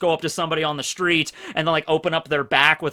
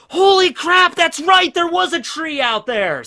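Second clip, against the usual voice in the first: sudden high, loud burst of realization; surprised exclamation; 10 semitones higher, 5 dB louder than usual. Retold or imitated. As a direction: blurt it out like a memory just hit you. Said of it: "and I'm like".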